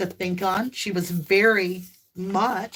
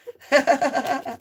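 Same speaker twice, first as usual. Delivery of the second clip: laugh; 4 semitones higher than usual. Real, not acted. Laughing at chat.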